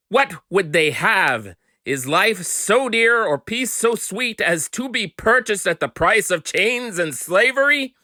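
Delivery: impassioned climax. Acted. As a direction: rise to a burning plea for liberty. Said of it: "The following is a dramatic reading".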